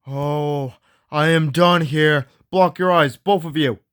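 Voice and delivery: ordinary talk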